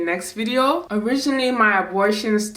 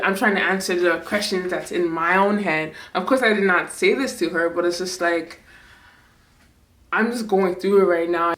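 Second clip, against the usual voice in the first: ordinary talk, nothing marked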